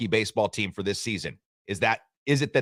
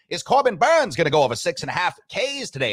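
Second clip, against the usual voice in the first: put-on high, old-timey radio announcer; 1920s sportscaster voice; 7 semitones higher, 3 dB louder than usual. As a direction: call it like an old radio broadcast. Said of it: "nineteen twenty sportscaster's voice".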